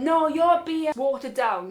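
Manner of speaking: retold drunken accusation. Drunk friend accusing hotel worker, loud indignation. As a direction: imitate a tipsy friend complaining.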